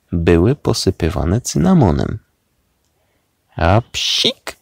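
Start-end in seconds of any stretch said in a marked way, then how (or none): 3.6-4.6 sudden voiced "a-psik" sneeze; acted sneeze. A narrator's reading. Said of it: "kichnął znienacka Kudłaty". (nagłe, aktorskie kichnięcie)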